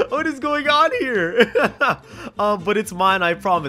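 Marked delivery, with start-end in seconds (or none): 0.0-2.0 high, drawn-out question through laughter; helplessly baffled and giggling; laughing disbelief. Real, not acted. Streamer lost at the chat's replies, laughing in disbelief. Cracking up at strange chat.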